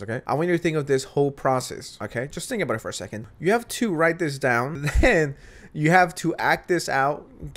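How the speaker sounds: ordinary delivery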